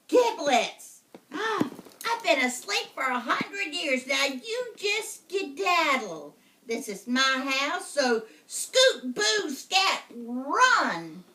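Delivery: high, loud, slow witch voice; gasp, then shoo him off; startled witch. Acted. Witch waking after a hundred years, shooing the boy loudly. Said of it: "She looked at her watch".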